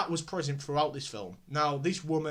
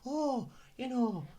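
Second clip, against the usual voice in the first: high, slow imitation of a Scottish woman; 8 semitones higher, 3 dB quieter, slower than usual. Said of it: "she's, like, Scottish".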